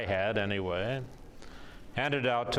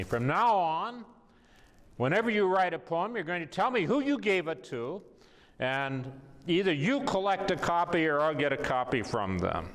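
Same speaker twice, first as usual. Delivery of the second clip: imitating a colleague's scolding lecture, pitched higher; lecturing; 6 semitones higher than usual. Colleague lays down rules to the poet, lecturing. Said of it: "then she started lecturing me".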